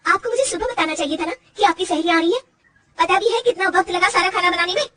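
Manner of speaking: angry retort. Acted. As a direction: snap back at the mother-in-law. Daughter-in-law talks back angrily. (गुस्से में सास को जवाब)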